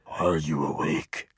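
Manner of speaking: low, slow eerie demon whisper-voice; demon voice prank. Pretend. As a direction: creep out your wife in the dark with a slow, low demon voice. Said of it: "the demon voices that I come up with".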